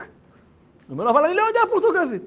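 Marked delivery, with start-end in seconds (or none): 1.1-2.2 high, loud, fast objection; flustered, loud and hurried; acted protest. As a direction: blurt it out in raised-pitch alarm. Acted protest at being sent away.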